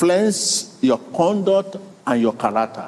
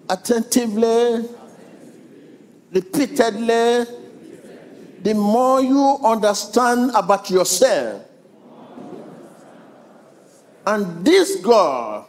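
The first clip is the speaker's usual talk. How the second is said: higher, slow, rising exhortation; preaching build; 8 semitones higher than usual. Acted. Preacher exhorting congregation to read Bible, higher and slow. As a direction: lift the crowd with each phrase.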